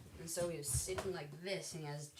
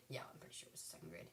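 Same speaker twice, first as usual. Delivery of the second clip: quick whispered aside; whispering; 11 semitones lower, 13 dB quieter, faster than usual. Real, not acted. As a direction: whisper it fast to yourself. Aside to herself.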